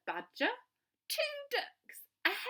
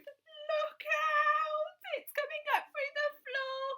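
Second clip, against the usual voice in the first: alarmed; 4 dB louder than usual. Acted. Animals warning, panic.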